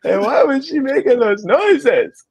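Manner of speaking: high imitated toddler asking. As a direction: ask it like a puzzled three-year-old. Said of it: "He goes".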